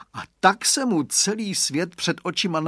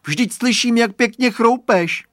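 amused accusation; 9 semitones higher, 8 dB louder than usual. A narrator's reading. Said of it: "Zasmál se Radovan".

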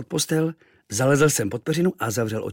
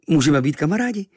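higher, louder "kamarádi" in a friendly offer; hopeful boy; 5 semitones higher, 5 dB louder than usual. A narrator's reading. Boy offers native friendship, high and hopeful "kamarádi". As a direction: ask about being "kamarádi" eagerly.

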